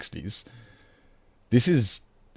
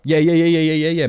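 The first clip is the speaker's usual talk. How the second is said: quick, higher and louder yeahs of recognition; excited yeahs; 8 semitones higher, 7 dB louder than usual. Real, not acted. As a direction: fire off the yeahs as it clicks. Recognizing [name] in Rocky Horror.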